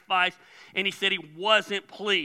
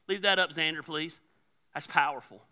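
normal talking voice

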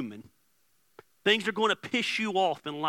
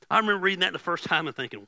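normal talking voice

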